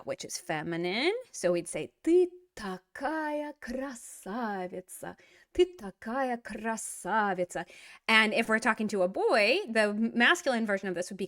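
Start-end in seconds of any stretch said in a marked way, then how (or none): 2.0-7.7 slow model phrase. Shown as a demonstration. Teacher models phrase slowly.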